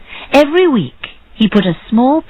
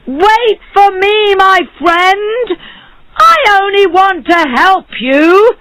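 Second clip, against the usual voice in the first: high, drawn-out calling shout; shouting after someone; 12 semitones higher, slower than usual. A narrator's reading. Barber chasing his fleeing friend, shouting. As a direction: call after him, high and pleading. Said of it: "followed him and shouted".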